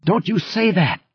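high, shrill-pitched shriek of a frightened mother. Acted. Liz shrieking at Stark to deny he is the father, terrified shriek. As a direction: scream it back at him in panic. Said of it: "she shrieked at him".

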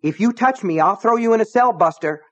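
high, loud, tense threat; angry warning. A narrator's reading. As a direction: stand your ground and shout him down. Deputy warns selectman off.

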